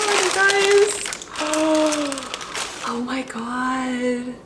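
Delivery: high squeal, then a breathy, slow oh my God; thrilled high gasp and hushed awe; delighted gasp. Real, not acted. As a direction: gasp at the gift, then melt. Opening the gift bag.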